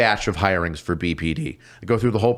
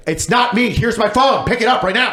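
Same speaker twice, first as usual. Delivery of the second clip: urgent insistence; 10 semitones higher, 3 dB louder than usual. Retold or imitated.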